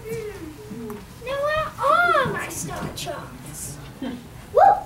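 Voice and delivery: put-on old astrologer. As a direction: potter about like a wise old man. The astrologer searches his charts.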